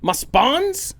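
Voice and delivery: loud, high, half-sung outburst; mock outrage. Dad loudly scolding whoever took his kids. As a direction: playfully shout it like a protective dad.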